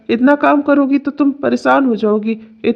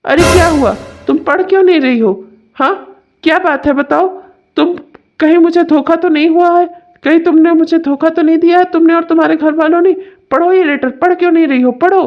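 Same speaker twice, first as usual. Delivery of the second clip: raised, higher-pitched accusing questions; angry suspicion; 5 semitones higher than usual. Acted. Husband suspecting his wife cheated him, angrily demanding she read. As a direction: press her hard, demanding an answer.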